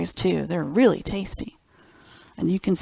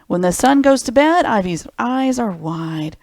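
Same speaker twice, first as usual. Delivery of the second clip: gentle picture-book narration; reading to children; 5 dB louder than usual. Acted. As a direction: read it like bedtime storytime. Reads picture book aloud.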